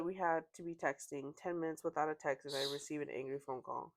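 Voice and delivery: normal talking voice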